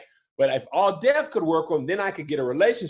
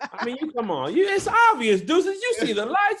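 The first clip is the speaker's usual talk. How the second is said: higher, louder exasperated telling-off; exasperated scolding; 10 semitones higher, 3 dB louder than usual. Real, not acted. Dad scolding his son over the obvious filming, exasperated and raised.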